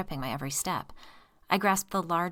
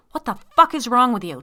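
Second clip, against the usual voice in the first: loud, high furious demand; man doused in fluid, yelling angrily; angry demand; 7 semitones higher, 13 dB louder than usual. Acted. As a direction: demand it in fury. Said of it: "Tom demanded".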